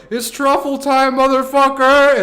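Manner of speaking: a hollered rallying cry, slowly; hype yell. Pretend. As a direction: bellow it across the forest to the dog. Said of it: "be like".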